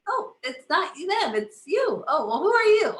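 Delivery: high, lively surprised inner voice; high, playful mock surprise; mock surprised recognition. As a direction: act out spotting an old foe. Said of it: "Then we come back and we say".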